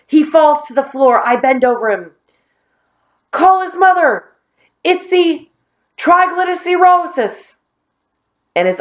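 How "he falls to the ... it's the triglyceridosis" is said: high, hammed-up alarm; mock panic. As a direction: play up a pretend medical emergency. Joe hams up Billy's collapse.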